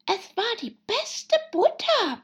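very high, meek animal voice; meek March Hare. Acted. March Hare answering the scolding Hatter, high and meek. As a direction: protest sheepishly in a high hare voice. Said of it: "erwiderte der Märzhase kleinlaut".

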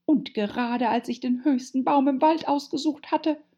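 high, agitated pigeon voice; rising complaint. A narrator's reading. Pigeon working up its grievance to Alice, agitated. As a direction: complain hard, not yet shrieking. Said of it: "ihre Stimme bis zum Schreien steigerte".

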